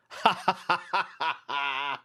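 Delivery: rapid, even ha-ha-ha laugh; staccato laugh. Real, not acted. Podcast host amused by a listener's fake British persona, staccato laugh. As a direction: laugh out in a quick rattle.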